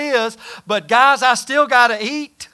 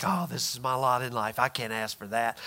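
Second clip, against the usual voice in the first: softer, lower, resigned mumble; meek resignation; 11 semitones lower, 12 dB quieter than usual. Retold or imitated. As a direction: sound beaten down, as if you deserve nothing. Meek self-pitying believer.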